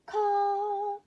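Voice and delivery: very high, loud crow caw. Acted. Baby crow finally singing, loud caw. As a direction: blast out a caw. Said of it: "so loudly that everyone leapt".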